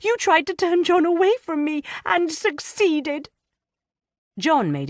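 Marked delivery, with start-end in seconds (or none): 0.0-3.3 weeping wife accusing her mother-in-law; sobbing. A narrator's reading. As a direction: sob it at your mother-in-law.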